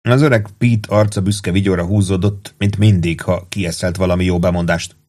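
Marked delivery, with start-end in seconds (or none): none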